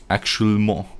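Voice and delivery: attempted French "actuellement"; tentative go at French pronunciation. Shown as a demonstration. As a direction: try "actuellement" as a French word. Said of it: "say that in French".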